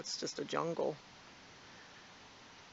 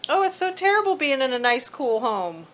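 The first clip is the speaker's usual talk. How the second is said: sarcasm; 7 semitones higher, 12 dB louder than usual. Acted. Mocking the cat's complaint.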